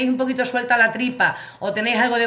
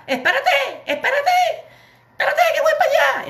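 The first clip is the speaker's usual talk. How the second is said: high laughing cry. Retold or imitated. Rushing desperate to pee, calling María in a high, laughing squeal. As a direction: squeal it, much higher, through laughter.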